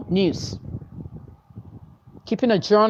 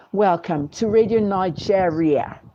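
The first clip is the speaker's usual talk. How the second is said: slow, put-on British radio announcer; affected British accent on "Nigeria"; mock British accent; slower than usual. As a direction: imitate a fake British newsreader. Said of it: "make up a British accent".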